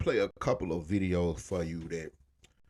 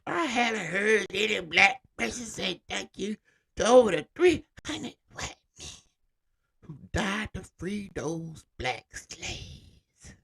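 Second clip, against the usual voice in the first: put-on higher, louder whiny old caller voice; C-SPAN caller; 12 semitones higher, 6 dB louder than usual. Quoting a C-SPAN caller on Juneteenth, high indignant whine. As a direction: play the indignant old caller, high and loud, slowing at the end. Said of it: "C-SPAN caller on Juneteenth".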